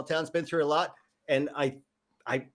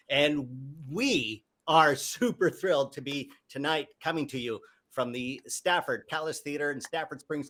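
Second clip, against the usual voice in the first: ordinary talk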